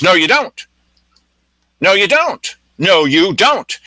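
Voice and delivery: acted-out obstinate denial; stubborn contradiction. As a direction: imitate a man flatly refusing to listen. Man arguing back in his face.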